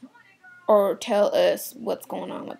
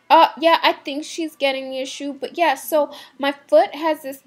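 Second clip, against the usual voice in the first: put-on chatty shopper voice, higher and louder; rambling customer; 5 semitones higher, 6 dB louder than usual. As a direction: play the oversharing customer, pitched up.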